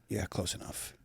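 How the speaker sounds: very soft, low reply; quiet concession. Retold or imitated. Host humoring his partner's mistake, quiet concession. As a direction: let it drop to a low, hushed concession.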